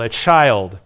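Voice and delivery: normal talking voice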